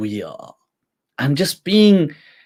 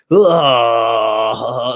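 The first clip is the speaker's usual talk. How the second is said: frustrated groan; 4 semitones lower, 5 dB louder than usual. Retold or imitated. Venting frustration at the official.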